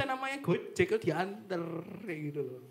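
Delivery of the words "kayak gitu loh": ordinary talk, nothing marked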